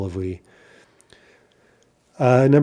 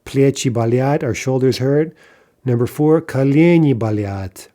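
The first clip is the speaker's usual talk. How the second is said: ordinary delivery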